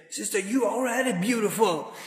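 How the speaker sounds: high, fervent encouragement; spirited pep talk. Retold or imitated. Speaker encouraging the sisters, spirited pep talk. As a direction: pump the sisters up with fervour. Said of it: "he said it with so much himmat".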